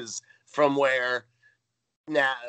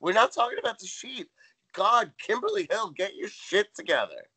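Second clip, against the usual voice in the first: high, hammed-up exasperation; mock exasperated scolding; 8 semitones higher than usual. Natalie scolding Tootie over the sheet, high mock exasperation.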